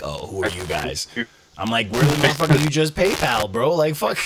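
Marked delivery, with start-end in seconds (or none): 0.0-1.1 mock dismissiveness. Imitating snobbish clients. 1.9-4.2 indignant comeback. Retold or imitated. Acted indignant comeback to clients.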